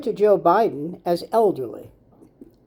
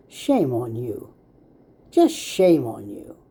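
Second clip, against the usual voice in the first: grave scolding; 7 semitones lower, slower than usual. Acted. Scolding the host.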